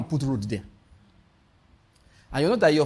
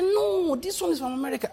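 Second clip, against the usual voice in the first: higher-pitched insistent friend, brushing off doubt; friend insisting; 14 semitones higher than usual. Retold or imitated. Friend repeating the scheme is from America, higher eager insistence. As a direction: push back eagerly in the friend's voice. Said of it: "He said".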